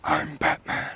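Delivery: hushed gravel-free superhero impression, very soft; Batman. Axe reviewer joking that the axe looks like a superhero, hushed impression. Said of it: "That was the worst Batman impression".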